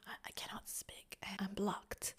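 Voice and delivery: tight, strained whisper; constricted whisper. Shown as a demonstration. Coach voicing a small, blocked insecurity in a whisper. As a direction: squeeze the air out, blocked. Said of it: "the sound that I'm gonna give to that".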